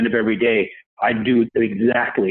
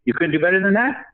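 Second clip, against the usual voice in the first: high, disdainful put-down; scornful disbelief; 8 semitones higher than usual. Retold or imitated. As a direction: sneer at a weak effort, pitched up. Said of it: "this twisted look".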